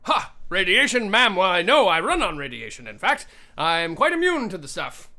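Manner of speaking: sing-song, quick robot butler patter; eager robot servant. Acted. Robot introducing itself to a ma'am, chipper servant voice. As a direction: rattle off the model number with courteous pep.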